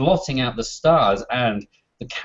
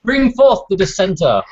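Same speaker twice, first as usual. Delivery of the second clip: herald voice; 9 semitones higher, 5 dB louder than usual. Acted. Herald commanding crowd.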